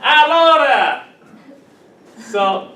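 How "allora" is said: high, slow Italian "Allora"; hammed-up Italian. Shown as a demonstration. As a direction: sing out "Allora" like a stage Italian. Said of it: "Italian word".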